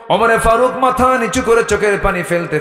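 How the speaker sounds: high chanting sermon climax; full-force preaching. A narrator's reading. Preacher telling of Omar in tears, high preaching chant. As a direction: start high and ringing, easing as it goes.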